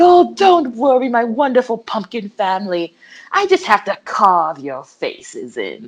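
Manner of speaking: lightly voiced cartoon villain; Pumpkinhead. Pumpkinhead soothing Courage about his pumpkin family, lightly acted villain. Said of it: "he's like, he's like".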